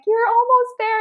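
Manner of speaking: high, bright cheer from strangers; high encouragement. Retold or imitated. Strangers urge an exhausted runner, high cheering. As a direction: urge her on to the finish.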